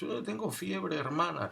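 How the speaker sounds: put-on high, small voice of a feverish boy; meek sick boy. Boy telling the nun he has a fever, high and meek. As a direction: plead like a sick child to the nun.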